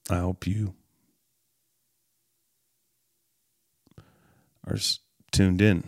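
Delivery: sign-off running out of steam, sinking in pitch and volume; weak, fading ending. Real, not acted. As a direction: trail off low and very soft. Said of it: "A little weak on the end".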